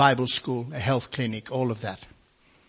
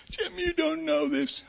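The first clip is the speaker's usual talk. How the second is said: high, slow comic voice for the big man; silly put-on voice; slower than usual. Preacher mimicking the tearful big man in a silly high voice. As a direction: ham up the big man's voice before dropping it. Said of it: "I better use a proper voice".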